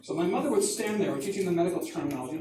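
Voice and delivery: ordinary delivery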